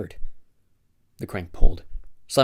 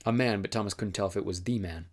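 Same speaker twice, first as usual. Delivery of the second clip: plain reading voice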